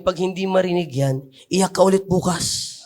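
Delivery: ordinary talk, nothing marked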